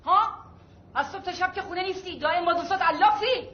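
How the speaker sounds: high-pitched, nagging reproach; angry scolding. Acted. Mother scolds her son for idling, angry.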